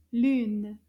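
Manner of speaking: lune said clearly with a rounded French u; repeat the word. Shown as a demonstration. Teacher repeating lune for children, rounded u. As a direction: say lune loud and clear for the class. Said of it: "Let's say it again".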